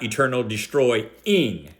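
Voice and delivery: "destroying" drawn out, stress on the -ing; slow down "destroying" and punch its final -ing; quoted emphasis. Retold or imitated. Preacher quotes his opponent's emphasis, "destroying" slow with a stressed -ing. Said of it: "His emphasis, not mine".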